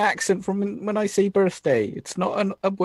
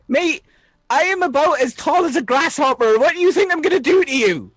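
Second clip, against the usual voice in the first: incredulous, louder-edged protest, pitch raised; indignant; 3 semitones higher, 3 dB louder than usual. Acted. Small Arthur disputing Hawk's accusation, indignant. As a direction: protest hotly at being called a threat.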